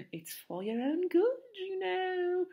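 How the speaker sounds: high, mock-virtuous lilt; saintly Peeves. Peeves pretending to care for the children, high saintly voice. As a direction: feign saintly concern in a high voice. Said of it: "in a saintly voice".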